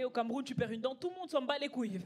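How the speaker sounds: ordinary delivery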